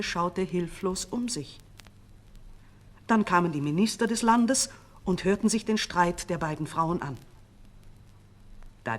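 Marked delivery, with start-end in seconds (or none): none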